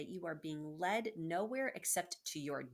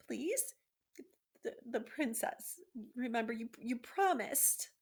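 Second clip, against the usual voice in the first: teary plea; 6 semitones higher than usual. Acted. Frightened mouse to the rat, a teary plea. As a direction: beg through tears, faltering. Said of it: "he was crying now".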